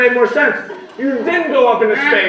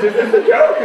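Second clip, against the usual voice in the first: laughing; 11 semitones higher than usual. Real, not acted. Laughing disbelief.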